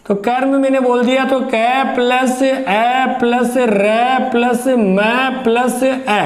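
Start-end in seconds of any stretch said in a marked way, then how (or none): 1.4-6.2 slow breakdown. Shown as a demonstration. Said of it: "spelling out sounds".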